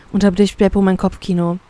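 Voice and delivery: ordinary delivery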